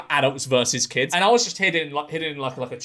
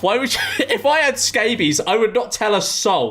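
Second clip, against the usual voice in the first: normal talking voice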